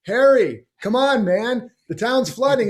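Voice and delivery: a rescuer shouting over floodwater; pleading rescuer. Retold or imitated.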